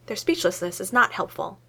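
ordinary delivery, nothing marked

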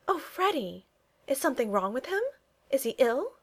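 high-pitched, anxious questioning. A narrator's reading. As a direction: ask with rising concern, pitched high. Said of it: "she asked anxiously".

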